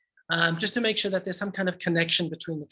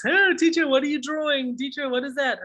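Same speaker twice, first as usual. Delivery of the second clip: eager pupil; 7 semitones higher than usual. Retold or imitated. Teacher voices eager pupils.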